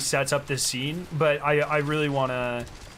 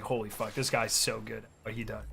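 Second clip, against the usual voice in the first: muttered aside; 5 semitones lower, 9 dB quieter than usual. Retold or imitated. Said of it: "I was just like".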